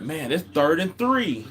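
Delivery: high, exasperated scolding of a passive quarterback. Retold or imitated. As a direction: lecture him like a frustrated fan yelling at the TV. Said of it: "I'm like, it's like".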